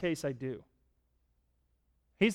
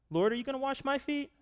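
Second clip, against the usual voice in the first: startled question; 10 semitones higher than usual. Retold or imitated. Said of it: "I think he said it with reluctance".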